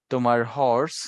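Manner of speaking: "হর্স" with a clearly breathed h; voiced h. Shown as a demonstration. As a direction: sound the h in "হর্স" fully. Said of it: "এই হর্সের উচ্চারণ কিন্তু এইচ এর মতোই হয়েছে".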